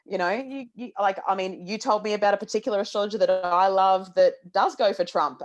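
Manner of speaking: ordinary talk, nothing marked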